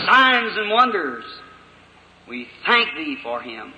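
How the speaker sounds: public prayer. Acted. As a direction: pray aloud for the congregation, building then fading.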